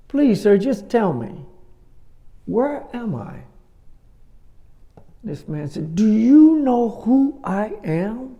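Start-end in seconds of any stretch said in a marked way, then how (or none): 0.1-3.4 pleading villager. Retold or imitated. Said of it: "Last try, he says". 6.0-8.4 higher, louder and slow, each word weighed; indignant aristocrat. Retold or imitated. Aristocrat's third, indignant reply.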